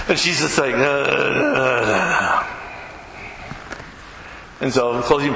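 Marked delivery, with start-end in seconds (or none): 0.7-2.4 imitating a frightened whimper, sinking low; mock whimper. Helper whimpering in fear about the snake. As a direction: whimper like she's scared stiff.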